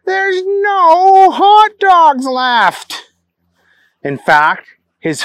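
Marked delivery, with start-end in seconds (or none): 0.0-3.1 high, slow, loud lament; slow dismayed cry. Acted. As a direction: stretch it out in despair. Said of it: "he cried out".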